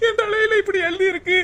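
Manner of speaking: high, sobbing lament; tearful despair. Acted. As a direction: wail softly over a cruel fate. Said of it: "அழுகாதடி".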